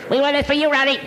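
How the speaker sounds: very high squeaky cartoon voice; helium voice. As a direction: squeak it out like Mickey Mouse. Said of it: "Let's get a helium voice".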